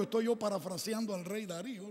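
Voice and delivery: ordinary delivery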